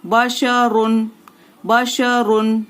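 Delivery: बशरुन drawn out slowly, full ru; slow recitation. Shown as a demonstration. Teacher reciting a word for students, slowly. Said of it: "रॉ दो पेश रुन".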